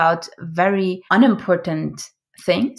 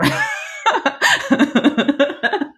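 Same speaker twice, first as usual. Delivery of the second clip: laughter; 8 semitones higher, 4 dB louder than usual. Real, not acted. Laughing at own pirate voice.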